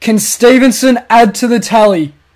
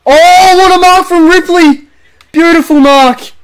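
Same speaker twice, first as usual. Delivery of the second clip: higher, louder "Oh" leading into the mark call; excited call; 7 semitones higher, 4 dB louder than usual. Acted. Calling a spectacular mark for viewers, amazed. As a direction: marvel at the catch on air.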